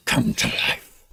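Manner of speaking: unvoiced gravelly hobgoblin mutter; raspy mutter. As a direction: whisper it like a sly goblin spell. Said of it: "he mutters".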